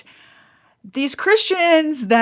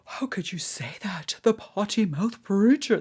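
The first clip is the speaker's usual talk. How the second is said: lightly voiced scandalized churchgoer, fading to a whisper; mock the prim scold, softer and softer; half-hearted pearl-clutching whisper; 4 dB quieter than usual. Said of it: "That's how they talk".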